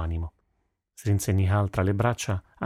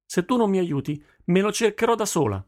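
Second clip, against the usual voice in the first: put-on much higher-pitched, defiant girl; defiant daughter; 11 semitones higher than usual. A narrator's reading. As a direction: challenge your father head-on. Daughter defies father in quarrel. (tono di sfida)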